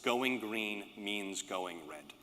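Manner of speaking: slow, deliberate summing-up line; spelled-out slogan, very slow; slow punchline. Real, not acted. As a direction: land the slogan word by word.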